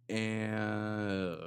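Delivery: long creaky vocal fry. Shown as a demonstration. Podcaster testing the compressor, drawn-out vocal fry. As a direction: drag the sound out into a crackling fry. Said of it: "what the compressor will do to a vocal fry".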